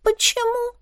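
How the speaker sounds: small, high frightened voice; frightened question. A narrator's reading. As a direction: ask timidly, pitched high. Frightened maid questions the witch.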